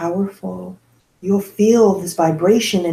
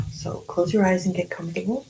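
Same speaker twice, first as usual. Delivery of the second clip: guided meditation. Acted. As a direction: lead them gently through breath and affirmation.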